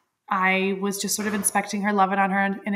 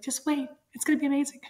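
higher, soft comfort to her younger self; reassuring past self; 6 semitones higher, 4 dB quieter, faster than usual. Pretend. Telling her younger self to hold on, tender and high. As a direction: gently promise it will be fine.

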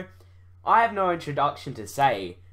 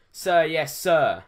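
loud, raised soldier's reply; military acknowledgement; 4 semitones higher, 7 dB louder, slower than usual. Acted. Private obeying an officer, loud military reply. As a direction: answer the order like a recruit.

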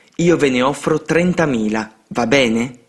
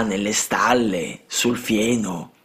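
sad confession; 3 semitones lower, 4 dB quieter, much slower than usual. A narrator's reading. Homeless boys confess.

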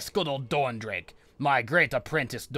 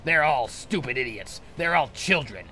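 sorcerer railing at his useless apprentices; hammed-up exasperated tirade; contemptuous rant; 5 semitones higher, 8 dB louder than usual. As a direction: spit out each insult with a fresh punch. Sorcerer rants at failed apprentices.

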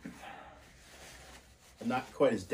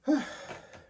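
heavy sigh. Real, not acted. Sigh mid eating challenge.

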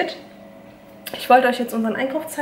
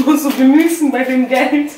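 laughing and louder; 3 semitones higher, 8 dB louder than usual. Real, not acted. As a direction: say it through a laugh. Repeats line to mother.

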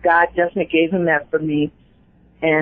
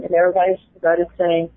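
ordinary talk

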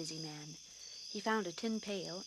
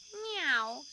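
loud high meow; 7 semitones higher, 7 dB louder than usual. A narrator's reading. Narrator voicing the cat, loud high meow. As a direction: cry like a cat.

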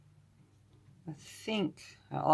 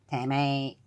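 Southern American accent. Shown as a demonstration. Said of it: "in, like, a Southern American accent".

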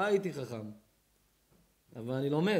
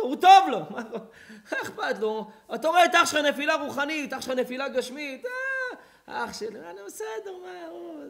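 high, loud climbing cries; fiery preaching; 11 semitones higher, 9 dB louder, slower than usual. Acted. Preacher cries out against brothers who ignore blood, fiery. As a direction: build to a screamed climax.

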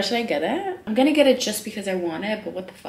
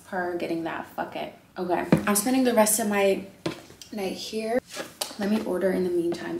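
usual speaking voice

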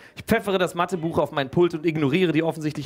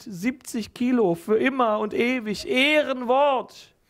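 drawn-out promise; 10 semitones higher, slower than usual. Acted. Solemn promise of sweets.

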